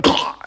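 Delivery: sharp strangled gah as the neck hits; a winded, choked gah. Retold or imitated. Kid slamming his neck on the trampoline bar, strangled gah. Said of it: "that's the noise I made".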